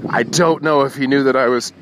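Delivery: ordinary delivery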